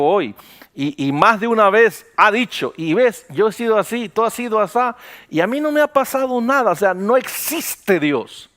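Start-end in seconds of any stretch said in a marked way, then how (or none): 2.8-6.7 defiant boast. Retold or imitated. Mocking a defiant old relative.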